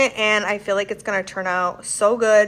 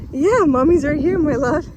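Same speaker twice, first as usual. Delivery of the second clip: higher, sweet reassuring tone; 7 semitones higher than usual. Real, not acted. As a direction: coo to a pet you love. Reassuring her dog on a walk.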